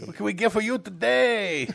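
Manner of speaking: mock Mexican accent. Host as hotline operator, mock Mexican accent. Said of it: "English with a Mexican accent".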